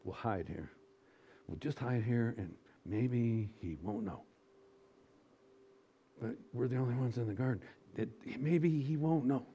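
soft, hushed scheming; mock sneaky whisper from hiding; conspiratorial hush. Adam and Eve hiding.

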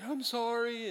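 high-pitched mock tearful apology; mock crying. Offender begging forgiveness in a daydream, mock crying. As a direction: pretend to cry an apology. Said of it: "they're crying and they're".

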